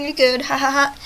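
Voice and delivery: ordinary delivery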